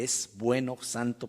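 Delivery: usual speaking voice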